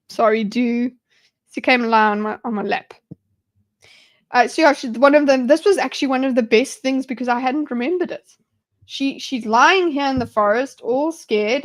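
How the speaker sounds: ordinary delivery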